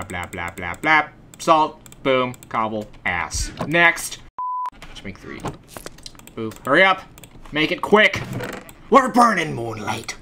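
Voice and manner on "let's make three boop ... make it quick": usual speaking voice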